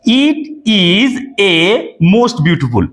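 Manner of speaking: each word of the sentence drawn out very slowly; slow dictation. Shown as a demonstration. Teacher reads the faulty sentence slowly to students. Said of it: "ভালো করে দেখো".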